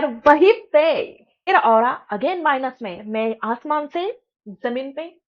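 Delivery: ordinary talk, nothing marked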